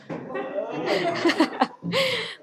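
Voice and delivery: high giggle breaking out; laughing. Real, not acted. Storyteller giggles at her substitute swear word, laughing. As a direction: burst into a quiet, high-pitched laugh.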